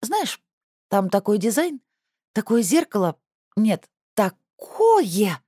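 high, lively and gushing; perked up. Acted. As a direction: gush about it with sudden enthusiasm. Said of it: "оживилась Надя".

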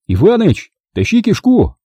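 high-pitched, shouted demand; demanding call. A narrator's reading. Driver calls from the smashed cab, demanding. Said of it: "потребовал он".

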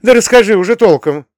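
high, loud, impatient outburst; exclaiming. A narrator's reading. Mother presses her son to explain properly, exclaiming. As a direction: demand a straight answer loudly. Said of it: "воскликнула Камила Альбертовна".

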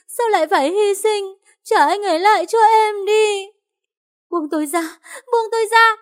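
hoarse, very high sobbing scream; woman held back by police, screaming hoarsely through sobs; hoarse crying wail. Acted. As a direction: howl like a caged animal, crying as she begs. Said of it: "tiếng gào khản đặc như con thú bị giam cầm, vừa khóc vừa nói".

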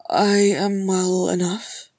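slow, drawn-out daze; dazed. Acted. Gimli reassures Legolas after the spell, dazed. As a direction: stretch the words as if coming to. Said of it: "blinking dazedly".

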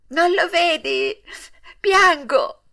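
slow, plaintive high reply; tearful retort. A narrator's reading. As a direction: answer in acted, upset crying tones. Weeping puppet answers the pigeon.

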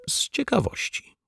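whispered, voiceless and sheepish. A narrator's reading. Neighbour confessing her curiosity to the detective, whispered. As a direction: breathe it out, half-ashamed.

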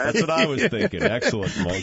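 high laughing reply. Real, not acted. Host reacts to the joke.

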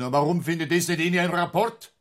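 pitched high and pressing, calling him out; indignant question. Acted. Superior grilling the inspector, indignant and high.

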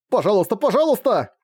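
high, whiny begging; desperate pleading. A narrator's reading. Brother keeps begging over refusal. (отчаянно упрашивая, высоким голосом)

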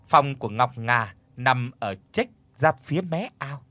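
plain reading voice

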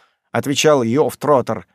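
ordinary delivery, nothing marked

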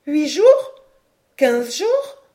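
high, loud alarmed questions; anxious questioning. A narrator's reading. Mother asks her son how long they are trapped, anxious. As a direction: press for an answer, voice jumping up.

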